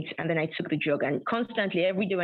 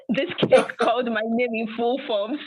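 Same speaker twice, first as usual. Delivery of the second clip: raised, louder voice breaking into laughter; amused confession; 4 semitones higher, 4 dB louder than usual. Real, not acted. Storyteller confessing temptation by a cake, amused.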